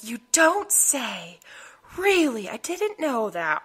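slow, heavy sarcasm; sarcastic. Retold or imitated. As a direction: roll the eyes, slowly. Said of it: "the sarcasm was real".

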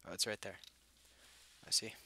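ordinary talk, nothing marked

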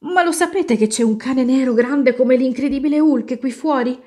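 high, loud urgent question; alarmed warning. A narrator's reading. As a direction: raise the alarm to the family. Boy raises the alarm to family.

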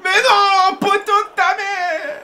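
crie plus haut et plus fort, « poteau » traîné; rageur. Real, not acted. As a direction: explose de rage contre le poteau. Rage sur un tir sur le poteau. (il peste contre le poteau, beaucoup plus fort)

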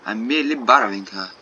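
hammed-up amateur French accent. Artist explaining the borrowed bunny to Jacob, French accent. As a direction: play a defensive artist with a bad French accent. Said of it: "so many shit French accents".

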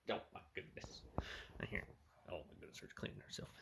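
breathy whisper, soft and hushed; whispering to cats. Real, not acted. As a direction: whisper tenderly so as not to startle the cat. Cooing at the cats.

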